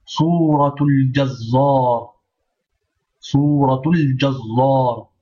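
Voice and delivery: Suratul Jazzar said slowly, the zz held for the shadda; teacher stressing the shadda, slowly; slow, careful. Shown as a demonstration. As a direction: stretch it out and double the z of Jazzar. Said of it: "Shadda, attention".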